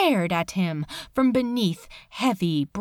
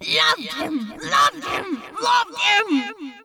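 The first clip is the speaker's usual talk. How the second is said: echoing chorus; 11 semitones higher than usual. Acted. Cave echoing Crowley's confession, echoing shout. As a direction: let it ring back like an echo. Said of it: "A whole chorus of Crowleys reverberated".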